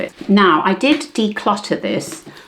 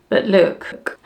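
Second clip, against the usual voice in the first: emotional. Real, not acted. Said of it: "I'm gonna get emotional now".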